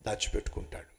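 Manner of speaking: barely voiced, held-in hush; hushed whisper. Preacher to the congregation on hiding anger, whispered. Wise man hides his anger.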